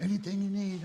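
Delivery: high-pitched, eager offer; excited butcher impression. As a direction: imitate a thrilled shop worker calling out. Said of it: "just all excited".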